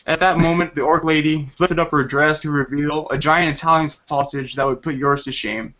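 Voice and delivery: plain reading voice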